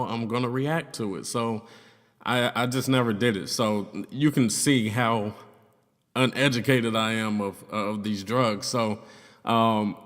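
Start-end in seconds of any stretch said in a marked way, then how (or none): none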